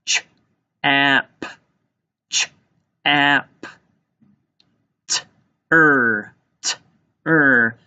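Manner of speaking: "Ch, ap", then "T, er", each sound on its own. Shown as a demonstration. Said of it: "Let's tap out the first syllable in chapter".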